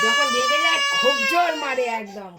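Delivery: high, loud, tearful wail; crying. Real, not acted. Wailing through tears that he hits very hard when angry. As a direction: sob out the complaint.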